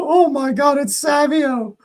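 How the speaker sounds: high, thrilled naming of the scorer; lifted in disbelief, not yet yelling; excited. Real, not acted. Streamer spotting the scorer, excited high voice.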